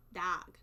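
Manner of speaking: put-on northern "dog", said dahg; northern pronunciation. Shown as a demonstration. Showing how northerners say it, "dog" as dahg. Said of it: "they go dog".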